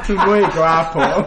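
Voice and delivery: put-on slow, loud, laughing Spanish-accented purr. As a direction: ham up a Latin flirt, slowly.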